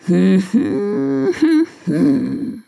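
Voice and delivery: drawn-out grumbling hum; noncommittal grumble. Acted. Elephant chief unsure how to answer, grumbling hum. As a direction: hum and grumble without committing. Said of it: "grummelt Elefantenchef Karl unbestimmt".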